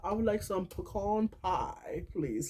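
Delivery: high, slow, drawn-out fake British voice; mock British accent. Politely ordering pie, in a mock British accent. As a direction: put on an over-proper British or Irish accent. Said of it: "a British person or Irish person".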